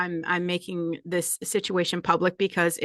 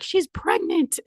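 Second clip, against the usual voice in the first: mocking gossip voice; 10 semitones higher, slower than usual. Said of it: "everyone's like".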